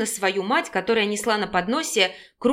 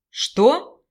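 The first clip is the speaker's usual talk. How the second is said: a touch of annoyed disbelief; puzzled irritation; 3 semitones higher, 4 dB louder than usual. A narrator's reading. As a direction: ask it baffled and mildly irritated. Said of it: "с непониманием и раздражением спросил". (недоумённо, с лёгким раздражением)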